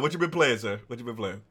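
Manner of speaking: usual speaking voice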